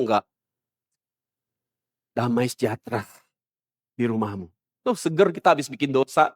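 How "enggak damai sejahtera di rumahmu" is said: much softer and lower, honeyed peace wish; mock soothing blessing. False pastor blessing a sinner, soft mock blessing. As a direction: bless them sweetly like a smooth false pastor. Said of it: "Tapi mereka bilang".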